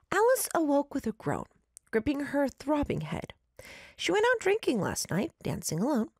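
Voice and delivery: story narration. A narrator's reading. Reads listener story aloud.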